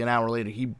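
ordinary talk

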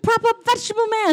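high, loud, rushed order; hammed-up panicky command; mock urgent shout. Retelling a toy yelling for help, mock urgent.

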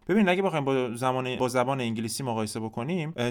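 ordinary talk, nothing marked

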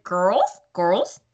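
cartoon villain accent, put-on; imitated animated-film character voice; Gru. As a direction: do a mock cartoon-dad accent on a summons. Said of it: "It reminds me of Gru".

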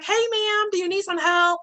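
high, friendly offer of help; lightly voiced kind passer-by; helpful stranger. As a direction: call out kindly to a stranded driver. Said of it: "he's like".

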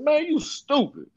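high, slow mocking friend voice; slow, high teasing disbelief; friend scolding. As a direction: drag it out like a friend calling you dumb. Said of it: "he said".

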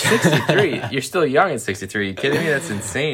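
high, laughing disbelief; incredulous laugh. Real, not acted. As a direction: react like it's absurd, laughing. Disbelief at friend's ideal age.

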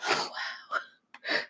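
soft, voiceless cry; tearful. Real, not acted. Mother moved by her son's letter, crying softly. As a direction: barely get it out through real tears.

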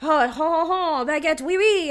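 mock French accent with laughing oui oui. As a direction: ham up a cartoon French accent. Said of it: "he's like".

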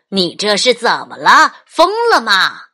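loud scolding shout; angry dad. Acted. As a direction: shout at the runaway child. Dad scolds runaway child.

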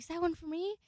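high, called out the window; yelling out the window. Retold or imitated. Shouting from the window to the roommate with a package. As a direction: call out from the window hopefully. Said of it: "So I yelled out the window".

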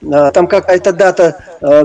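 ordinary talk

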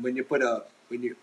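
normal talking voice

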